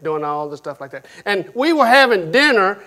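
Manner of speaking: ordinary talk, nothing marked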